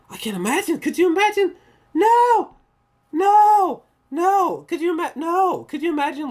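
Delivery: loud, high cries of refusal; horrified outburst. Real, not acted. Horrified at imagining going undercover.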